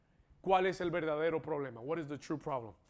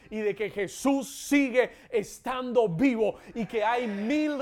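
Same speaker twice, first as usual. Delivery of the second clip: loud, rising Easter preaching that builds to a shout; preaching at full force; 4 semitones higher, 7 dB louder than usual. Real, not acted. Preacher rousing the Easter congregation to applaud, shouting. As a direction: whip the congregation up into praise.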